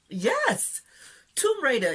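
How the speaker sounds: high, loud fangirl outburst; excited cheer. Real, not acted. Fan cheering Wonder Woman as Tomb Raider, excited. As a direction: cry it out in delighted approval.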